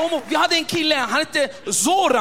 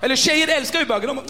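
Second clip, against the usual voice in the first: ordinary talk, nothing marked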